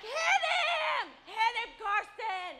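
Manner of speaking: loud high egging-on yell, slowing at the end; cheering shout. Retold or imitated. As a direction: shout it like a fan. Mom cheering son on.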